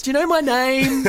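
high-pitched indignant bride imitation; mock upset bride. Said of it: "She was really upset".

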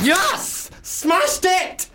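very high-pitched celebration; triumphant cheer. Real, not acted. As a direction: squeal it out in triumph. High celebration of own accent demo.

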